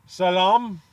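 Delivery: Salam said suh-LAHM, loud; Middle Eastern-sounding pronunciation. Shown as a demonstration. Joking it sounds like the Middle East, Salam as loud suh-LAHM. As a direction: hit a long AH at the end of Salam, loudly. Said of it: "it sounds like the, the Middle East".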